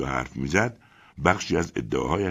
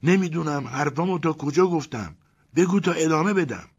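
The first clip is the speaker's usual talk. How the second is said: higher voice for Ivan, chatty; Ivan's voice; 12 semitones higher, 4 dB louder than usual. A narrator's reading. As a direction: lift the voice to play Ivan.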